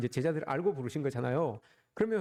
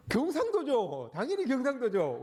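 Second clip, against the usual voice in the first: high, slow, teasing punchline with a laugh; joking boast; 8 semitones higher than usual. Real, not acted. Preacher teasing the congregation about Gyeongsang men, high mock boast. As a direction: play up the hometown pride with a grin. Playful regional joke punchline.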